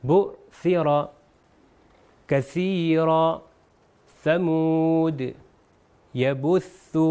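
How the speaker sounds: drawn-out model words with a clear tha; careful Arabic tha. Shown as a demonstration. Teacher showing examples of tha, careful drawn-out pronunciation. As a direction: chant each word slowly, tha articulated. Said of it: "سا کی مثال آپ کے سامنے ہے".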